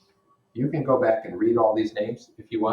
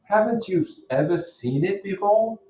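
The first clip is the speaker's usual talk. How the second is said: higher, louder eager Gatsby voice; Gatsby urging Nick to admire the car, pitched up; 11 semitones higher, 5 dB louder than usual. Acted. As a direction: press him eagerly, pitched up. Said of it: "He jumped off to get me a better view".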